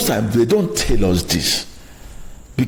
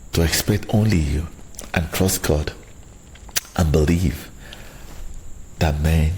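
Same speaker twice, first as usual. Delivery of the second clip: lower, softer closing pep talk; calm radio-host outro, lower and softer; motivational sign-off; 7 semitones lower, 5 dB quieter than usual. Acted. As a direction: wind down and sell the show gently.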